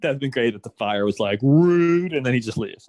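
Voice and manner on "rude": loud, drawn-out mock indignation; mock offended. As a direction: blurt it loudly as if insulted. Imagines the fire being offended.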